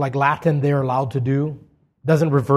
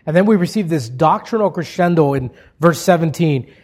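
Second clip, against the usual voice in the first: usual speaking voice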